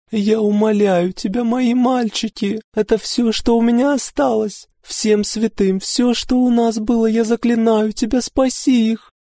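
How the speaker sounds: very high tearful pleading; cling and beg through sobs; desperate mother pleading; frantic begging. A narrator's reading. Mother begging for her sons.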